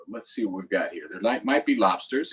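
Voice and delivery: usual speaking voice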